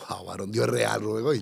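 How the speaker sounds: breathless whisper of wonder; awed whisper. Real, not acted. Whispering in awe to Rubén about God. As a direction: whisper it like you can't believe it. Awed hush over God's miracle.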